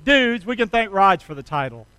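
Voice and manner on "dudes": drawn out and loud, leading the crowd; slow call to repeat. Shown as a demonstration. Preacher calling out the title to the congregation, slow and loud. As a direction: stretch it out so they join in. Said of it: "Can you say that with me?".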